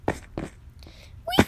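very high, thin rising squeak; playful squeak. Pretend. Gamer making idle noises while playing, playful squeak. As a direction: squeak out a tiny "weep".

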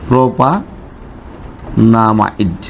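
"প্রোপানামাইড" very slow, split after প্রোপা with a long pause; slow writing-out pace. Shown as a demonstration. Teacher writing the name for the class, very slow, broken "প্রোপানামাইড". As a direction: stretch "প্রোপানামাইড" out as if dictating it. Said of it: "আমরা সেটাও লিখি".

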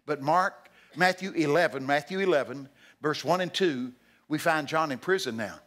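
usual speaking voice

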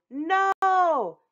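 loud, high shout of refusal; the captured princess shouting no at the wizard. Acted. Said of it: "Verbena shouted".

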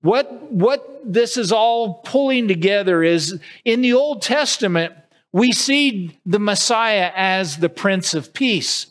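usual speaking voice

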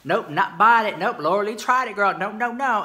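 mock the haters with sass; sassy mock shopper. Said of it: "you're the same ones who are sitting there".

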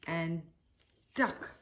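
ordinary delivery

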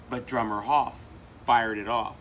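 ordinary delivery

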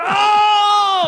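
very high shouted, long "ohhh"; imitated crowd uproar. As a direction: mimic a crowd losing their minds. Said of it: "And everyone went".

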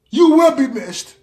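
high, loud, drawn-out lament; mock farewell. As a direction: proclaim the loss with hammed-up feeling. Mock farewell, repeated louder.

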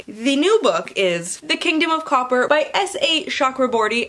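ordinary talk

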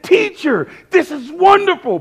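high-pitched imitated exclamation; excited. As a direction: gush like an overexcited disciple. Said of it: "Like, he's all excited".

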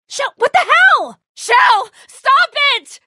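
high, loud frantic yell; panicked shout. Acted. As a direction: scream at her to stop. Yelling at sister to stop.

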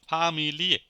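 "ফামিলিয়ে" with every syllable given its beat; sound by sound. Shown as a demonstration. Teacher showing every sound is spoken, syllabic "ফামিলিয়ে". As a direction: draw out "ফামিলিয়ে" as fa-mi-li-e. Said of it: "single, single ভাবে উচ্চারিত হয়".

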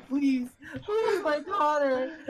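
high, slow tearful plea; hammed-up weepy begging through a laugh; crying. Mother begs for her daughter, mock crying. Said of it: "she's just crying".

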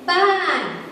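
Ban high, then dropping; Thai falling tone. Shown as a demonstration. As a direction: start Ban high and drop it at the end. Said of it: "You all know this".

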